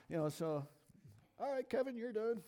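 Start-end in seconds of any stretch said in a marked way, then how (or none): 1.4-2.4 much higher put-on voice; voiced interrupter. Preacher imagines being called off the stage, high mock voice. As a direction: pitch it high to cut the speaker off. Voices someone cutting him off.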